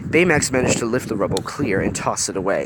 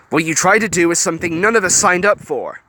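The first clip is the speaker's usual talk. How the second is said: stern rebuke; 8 semitones higher, 4 dB louder than usual. A narrator's reading. Wasabi scolding Hiro.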